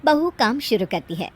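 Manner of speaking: ordinary delivery, nothing marked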